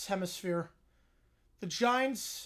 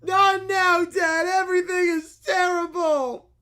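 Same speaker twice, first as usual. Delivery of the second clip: high, loud bratty shout; whiny yell; 5 semitones higher, 6 dB louder than usual. Acted. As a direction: yell at dad like a tantrum kid. Child yelling at dad.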